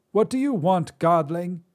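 put-on high, loud cranky old woman; old Drea. Old witch scolds the god who ruined dinner, high and loud. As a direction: snap at him like a crotchety crone.

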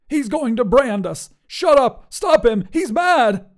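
high, loud, frantic wizard; panicked shouting. A narrator's reading. Wizard about to be branded, shouting in panic. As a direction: cry for help, high and frantic.

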